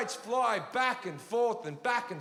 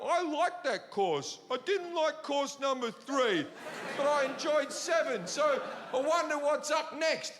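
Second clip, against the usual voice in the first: higher, mock-refined restaurant talk; fancy diner chat; 6 semitones higher than usual. Comedian voicing a diner chatting between courses, mock-posh. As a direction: play a diner musing over courses. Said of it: "you can go".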